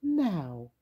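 high, sing-song witchy voice; bright, bossy little witch. Acted. Mavis voiced.

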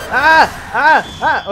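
short, high, loud yelps; startled yelps. Real, not acted. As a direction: yelp in alarm, one burst at a time. Enemies come near while shooting.

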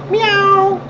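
very high squeaky little cat. Pretend. Cat asking Sandy what she is doing, tiny high voice.

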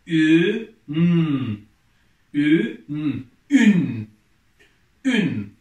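loud, drawn-out syllable reading for the class; reading syllables aloud. Shown as a demonstration. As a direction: sound out each letter clearly, then blend them loudly. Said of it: "Okuyalım".

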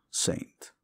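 Saint, breathed out with revulsion; disgusted. Real, not acted. Repeating the hated word to the viewers, disgusted whisper. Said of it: "I hate that word".

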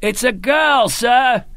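high, joyful shouted news. A narrator's reading. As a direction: call the news out over the din. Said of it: "he shouted at Colonel Gudin".

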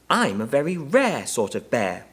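high-pitched, puffed-up little bear; self-important. A narrator's reading. Bear puffing out its chest, self-important. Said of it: "he replied importantly".

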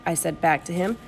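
plain reading voice

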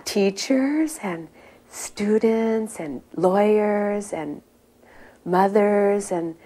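slow, halting list, searching for each word; straining to think. Retold or imitated. Nervous devotee answering her teacher, slowly searching for words. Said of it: "I was straining my brain".

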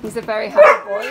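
normal talking voice

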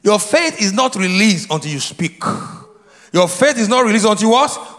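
usual speaking voice

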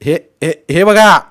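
building to a high shout; calling out. A narrator's reading. Boy readying his throw, calling out.

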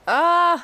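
sudden loud high yelp; startled cry. Real, not acted. Caught off guard by the choice, a loud startled yelp.

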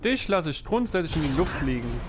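ordinary talk, nothing marked

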